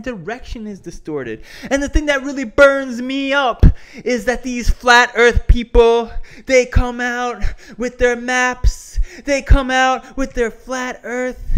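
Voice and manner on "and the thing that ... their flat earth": loud, high heated promo rant; wrestling promo. Pretend. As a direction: cut a fired-up wrestling promo.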